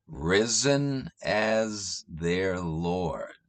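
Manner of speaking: drawn-out, soft echo of the phrase; slow, quiet repeat. Shown as a demonstration. Savouring the hymn line for listeners, slow and soft. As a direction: linger on each word of risen as their Lord.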